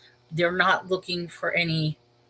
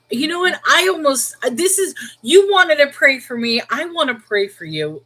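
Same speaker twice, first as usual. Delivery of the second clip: worked-up disbelief; 7 semitones higher, 6 dB louder than usual. Real, not acted. Indignant reaction to caller's prayer.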